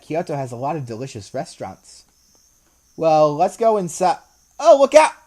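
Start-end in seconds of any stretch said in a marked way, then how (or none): 4.6-5.1 high urgent call; warning shout. Real, not acted. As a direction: yell a quick warning. Warning before head bump.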